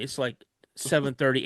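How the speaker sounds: ordinary talk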